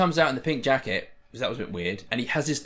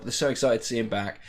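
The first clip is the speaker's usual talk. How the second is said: ordinary talk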